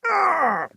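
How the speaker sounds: sharp high cry of pain. A narrator's reading. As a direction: cry out as the claw rips you. Nathaniel wounded by claw.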